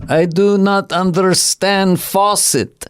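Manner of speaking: put-on Russian comic accent, drawn out slowly; a slow mock Russian accent. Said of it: "And everything was".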